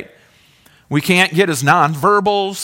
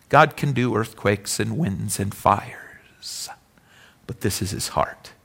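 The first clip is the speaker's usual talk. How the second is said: breathy near-whisper, slow; 8 semitones lower, 8 dB quieter than usual. Acted. Preacher whispering to the congregation about God's heart.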